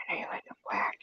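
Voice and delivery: sly unvoiced whisper; teasing whisper to grandma. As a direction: whisper it like a naughty secret. Whispered tease.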